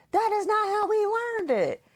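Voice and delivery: imitating daughter. Mother mimics daughter refusing homework help, high indignant whine. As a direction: play the stubborn fifth grader insisting. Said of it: "you're sitting here being like".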